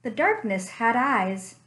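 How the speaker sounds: ordinary delivery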